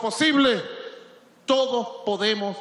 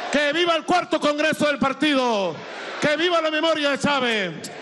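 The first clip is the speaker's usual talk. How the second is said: booming balcony speech; 3 semitones higher than usual. Real, not acted. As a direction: lead the crowd in chants. Final chants to the crowd. (discurso de balcón atronador)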